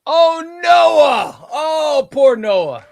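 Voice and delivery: high, loud cry of mock despair for poor Noah; dismayed cry. Real, not acted. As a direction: wail the name as if it hurts. Mock dismay at wheel elimination.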